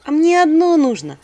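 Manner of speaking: high loud coaxing; persuading. Acted. Heroine persuading the stingy spirit. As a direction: try to talk him into it.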